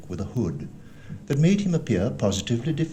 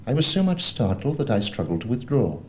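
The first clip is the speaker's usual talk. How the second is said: usual speaking voice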